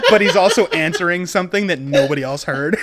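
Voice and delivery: high, loud amused explanation; laughing. Real, not acted. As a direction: explain it while cracking up. Amused game master over table laughter.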